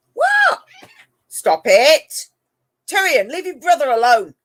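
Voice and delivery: loud, high telling-off; scolding the cats. Real, not acted. Owner calling off Tyrion from his brother, loud scold. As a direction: shout the order to stop.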